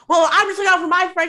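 high, loud, rushed imitation; he's mocked, loud and quick; fast loud mock. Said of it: "he's like".

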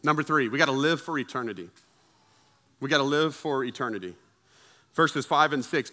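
ordinary delivery

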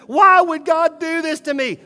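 mimicking a hurt churchgoer crying out to God; complaining believer. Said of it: "you're so concerned".

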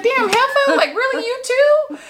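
hammed-up kid betrayed by his own mom; exasperated kid. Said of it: "And he was like".

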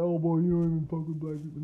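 loud, mocking jab at him; mock accusing shout. Imagined accuser scolding the speaker.

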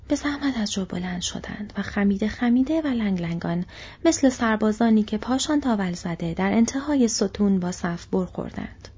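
plain reading voice

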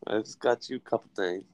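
holding back a laugh; trying not to crack up; suppressed laughter. Real, not acted. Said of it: "sounding like you're trying not to laugh".

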